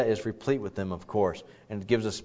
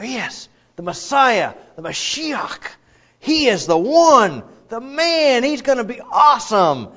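higher, louder, slow awed hope; eager anticipation; 10 semitones higher, 7 dB louder, slower than usual. Retold or imitated. Imagined readers hail the coming Messiah, awed and excited. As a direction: gush about the Messiah.

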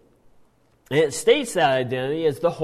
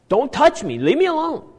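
higher, louder commanding yell; demon's command; 10 semitones higher, 5 dB louder than usual. Retold or imitated. Demon orders Jesus away in the synagogue, loud commanding yell. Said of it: "this is a command".

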